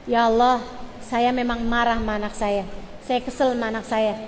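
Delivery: low, soft supplication; demonstrating a hushed parent's prayer; model prayer. Shown as a demonstration. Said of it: "doanya kayak gini nih".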